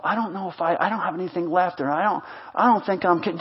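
soft, low, hurried protest; acted self-doubt. Discouraged person doubting they have anything left, quietly protesting. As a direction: tumble quietly through the excuses.

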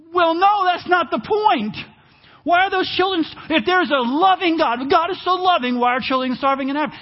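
high, loud indignant shout; mock outraged skeptic. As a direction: shout it back like an offended skeptic. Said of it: "skeptic defends angrily".

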